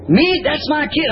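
high-pitched imitation of an objecting wife; indignant protest. Wife told to let go of her daughter, mock indignant protest. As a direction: jump up high, as if affronted.

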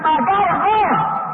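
retold hysteria. Preacher acting out a dying woman's despair, hysterical. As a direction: wail it slowly, imitating a panicked soul. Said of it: "don't get hysterically".

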